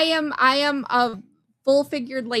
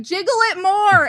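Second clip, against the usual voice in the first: mock shout; 7 semitones higher, 7 dB louder than usual. DNR officers shouting instructions.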